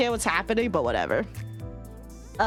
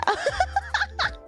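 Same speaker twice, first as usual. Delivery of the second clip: high giggle. Real, not acted. Laughing about new mic.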